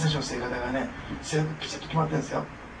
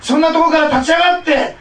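preaching at full force; 11 semitones higher, 11 dB louder than usual. Acted. Pastor calls the congregation to rise, fervent loud preaching. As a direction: build to a roaring altar call.

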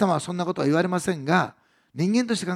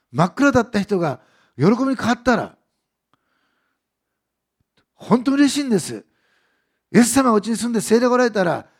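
loud, rising sermon climax; fiery preaching; 5 semitones higher, 7 dB louder than usual. Acted. Preacher urging the congregation with loud, rising joy. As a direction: preach it with full force, building. Preaching builds to full force.

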